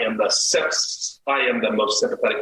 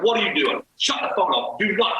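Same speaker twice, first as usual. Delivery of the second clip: retold screaming; 7 semitones higher than usual. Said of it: "I'm just screaming at her".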